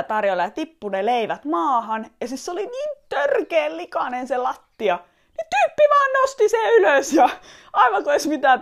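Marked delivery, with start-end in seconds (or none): 5.4-8.6 shrill-free but sharply higher, louder outburst of disbelief; scandalised exclamation; outraged high cry. Real, not acted. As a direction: blurt it out in shocked disbelief. Shocked at dirty toast.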